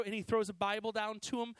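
normal talking voice

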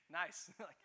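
usual speaking voice

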